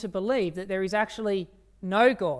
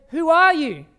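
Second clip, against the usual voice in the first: higher, much louder amazed cry; 8 semitones higher, 11 dB louder than usual. Retold or imitated. Young driver to the stranger who fixed his car, amazed. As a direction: blurt it out in wonder.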